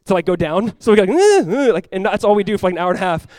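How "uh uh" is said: high, loud effortful grunts; imitated straining lurch. Boat gunned up and down waves, imitated straining grunts.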